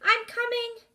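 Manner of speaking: high, loud call. Acted. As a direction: call out across the water. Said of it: "Minley called".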